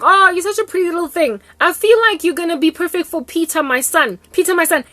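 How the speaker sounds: high, loud gushing mother; mother compliments the girl, high and loud; cooing mom. Retold or imitated. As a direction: translate her gush, still high and loud. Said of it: "This mother was like".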